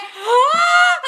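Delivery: sharp high gasp of delight; shrieking gasp of surprise; big excited gasp. Real, not acted. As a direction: gasp loudly, thrilled. Reacting to fan's news live.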